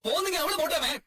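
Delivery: high-pitched, frantic appeal; desperate pleading. Acted. Son begs mother not to leave, desperate pleading. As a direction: beg her, voice pitched right up.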